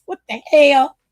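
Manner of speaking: loud indignant cry; mock outrage. Real, not acted. Host reacting loudly to a chat insult, mock offended. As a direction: throw it out in play offense.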